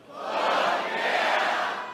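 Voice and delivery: audience yelling back together; the whole room hollers it; crowd shouts. Real, not acted. Audience answering the comic's invite, shouting. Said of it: "let's try it one time".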